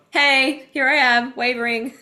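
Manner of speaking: high, loud laughing self-mockery. Real, not acted. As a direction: burst out laughing at yourself mid-reading. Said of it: "I'm laughing because it's like".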